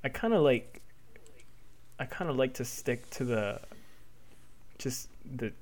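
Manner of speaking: halting, with long pauses; hesitant. Real, not acted. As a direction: feel your way cautiously through the answer. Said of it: "you're being so hesitant".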